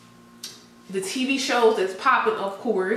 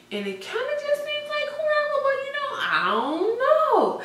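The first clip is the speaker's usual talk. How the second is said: high playful voice. Real, not acted. Playful karma remark, sing-song.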